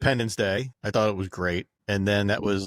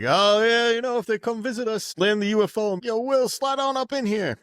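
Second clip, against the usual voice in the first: much higher playful [name] impression; mock [name]; 13 semitones higher than usual. [name] answering the host, high and playful. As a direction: toss it off like a cocky star. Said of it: "[name] was like".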